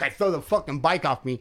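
ordinary talk, nothing marked

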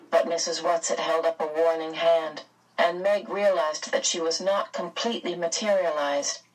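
usual speaking voice

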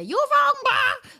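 very high, loud mock objection; hammed-up squeal of protest; imagined heckler. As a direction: yelp it like an outraged heckler. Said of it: "come after me and say".

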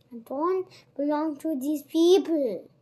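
loud desperate shout. Acted. Oliver shouts to the crowd while struggling, loud. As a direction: yell it out as you struggle. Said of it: "shouted Oliver".

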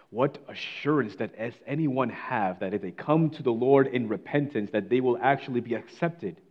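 ordinary talk

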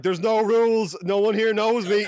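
high, loud cry of lawless abandon; yelled out high and loud, hamming it up; gleeful mock shout. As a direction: holler it like a tourist gone wild. Mock declaration of going wild abroad.

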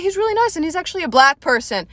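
high, fast, nervous rush; hurried high mock nervousness; mock awkward speaker. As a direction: squeak it out apologetically. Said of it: "I was like".

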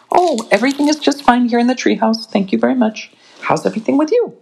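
high, quick, breezy telephone cheer; Mama covering up to Gran, sing-song; bright phone voice. Acted.

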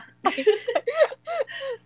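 squealing high laugh; high laughter. Real, not acted. Shared joke about Manny.

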